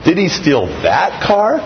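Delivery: car stressed with a rise; the word car hit. Shown as a demonstration. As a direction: lean on car, not some other thing. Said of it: "five different things".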